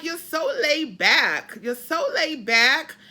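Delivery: put-on a light New England accent, stretched vowels; lightly imitated New England drawl on "laid back". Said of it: "let me see if I can do my New England accent".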